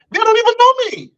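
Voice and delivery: high, indignant cry of disbelief; indignant outburst. Real, not acted. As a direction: flare up in outrage at the claim.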